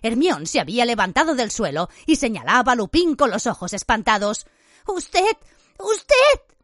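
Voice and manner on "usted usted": high cry building to a shout; shocked, very high outburst; horrified accusation. A narrator's reading. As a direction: accuse him, shrieking higher the second time. Hermione accuses Lupin, horrified.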